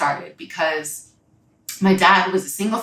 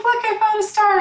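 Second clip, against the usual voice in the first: put-on much higher, bright childlike voice; excited little girl; 4 dB louder than usual. Young girl showing grandpa a star, much higher excited child voice. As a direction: squeal it like a proud small kid.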